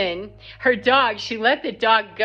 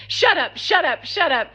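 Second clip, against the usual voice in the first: imitating her, higher-pitched repeated outburst; mock telling-off in a raised pitch; retold shut-up; 7 semitones higher than usual. Retells woman telling man off.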